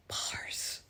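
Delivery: breathy whispered aside; whispering. Acted. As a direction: whisper it to yourself.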